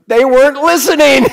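high, loud laughing punchline. Acted. Teasing the class that they weren't listening, laughing loudly. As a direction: land the joke while laughing.

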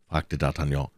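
plain reading voice

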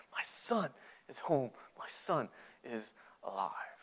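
hushed, slow and deeply moved; tender wonder. Acted. Father whose lost son has come home, hushed wonder. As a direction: marvel softly, letting each word land.

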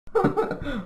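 short high-pitched burst of laughter. Real, not acted. Interviewer reacts to guest calling own voice fine, laugh. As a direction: let out a quick high laugh.

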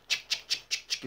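soft unvoiced chic chic of typewriter keys; typewriter clatter. Tapping the lawyer's typewriter, whispered clicks. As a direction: click out the typing like the lawyer's machine. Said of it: "tiene una máquina de escribir".

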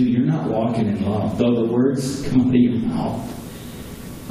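normal talking voice

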